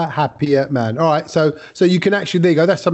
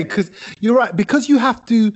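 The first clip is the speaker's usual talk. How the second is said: ordinary delivery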